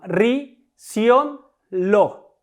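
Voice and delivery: "Ri", "sion", "lo" each said on its own; isolated stressed syllables. Shown as a demonstration. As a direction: pick out "Ri, sion, lo" one by one with pauses. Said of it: "Las sílabas tónicas en estas palabras". ("Ri, sion, lo", cada sílaba tónica por separado)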